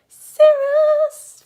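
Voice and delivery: very high, loud, long cirrus; pop star squeal. Shown as a demonstration. Teacher recapping the high clouds, high-pitched pop star squeal. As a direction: squeal and hold it as high as possible. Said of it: "very as high pitched as you can".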